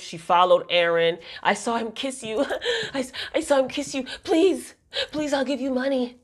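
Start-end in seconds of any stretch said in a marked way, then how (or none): 1.4-6.2 unhinged pleading laugh. Retold or imitated. Unhinged stalker begging Karen.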